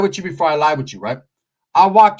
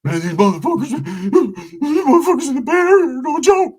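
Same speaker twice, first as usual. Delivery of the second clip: telling the homies; 9 semitones higher, 4 dB louder than usual. Retold or imitated. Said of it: "I tell the homies".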